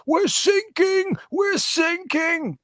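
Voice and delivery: very high, slow, drawn-out cry of a sinking sailor; panicked sailor. Retold or imitated. Sailor calling the coast guard in a very high, slow panic. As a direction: wail it like a sailor going under. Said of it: "calling the German Coast Guard".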